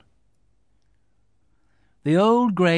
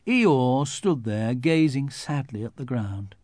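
ordinary delivery

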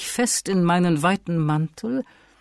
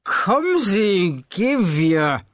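slow, higher insistent summons; drunken urging; 5 semitones higher, slower than usual. Acted. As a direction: pull him along eagerly. Fortunato urges them to go. (ungeduldig drängend, betrunken)

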